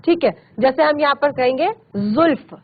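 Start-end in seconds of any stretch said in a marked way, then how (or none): 2.0-2.5 जुल्फ opening on a buzzing z; nukta z sound. Shown as a demonstration. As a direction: say जुल्फ as "zulf". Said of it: "जुल्फ नहीं कहेंगे".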